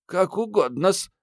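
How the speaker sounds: put-on frightened old servant, high and slow; напуганный старый слуга. A narrator's reading. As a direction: squeak it out slowly, cowed and obliging. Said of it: "напуганно просипел ключник". (испуганно, высоким стариковским голоском)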